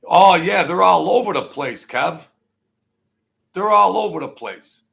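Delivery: loud, high excited call-out; loud excited exclamation about the deer. Real, not acted. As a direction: shout it across to Kev.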